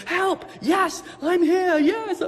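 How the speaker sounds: high, loud, overjoyed cries; joyful shout. Acted. Rescued castaway shouting with joy as helicopter lands. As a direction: shout for joy, slowly drawn out. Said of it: "jumping up and down, leaping around".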